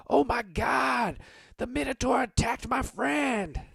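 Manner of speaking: high, slow, shouted alarm; panicked bystander. Acted. Buddy crying out to the crowd in high panic. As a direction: shriek it out like a terrified onlooker. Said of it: "his buddy ... says".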